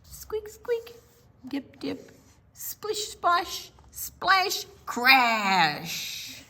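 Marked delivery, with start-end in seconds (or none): 0.0-4.7 playful high squeaks. A narrator's reading. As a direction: squeak it lightly. Playful sand sound words.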